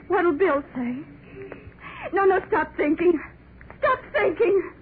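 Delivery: high, tearful pleading with herself; an anxious mother begs herself to stop worrying, near tears; panicked crying. Acted.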